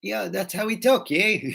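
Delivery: high-pitched mock South African; exaggerated South African with tacked-on "yeah"; South African accent. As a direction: imitate a South African explaining himself. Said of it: "南アフリカの人は".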